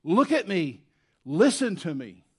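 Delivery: loud pleading command to God; urgent demand. Retold or imitated. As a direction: demand attention now. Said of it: "a better translation would be".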